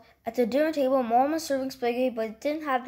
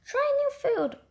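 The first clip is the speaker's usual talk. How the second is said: high sung-out motto; sing-song motto; 14 semitones higher than usual. A narrator's reading. Mom coaxing her son with a catchy rule, high. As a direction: chirp it high like a jingle. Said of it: "but it might taste mushy".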